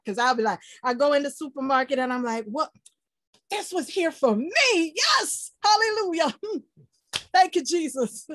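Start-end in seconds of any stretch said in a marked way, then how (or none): 2.5-8.3 high, bright shout of hallelujah; overjoyed praise. Retold or imitated. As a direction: celebrate the find like a gift from God. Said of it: "overly excited".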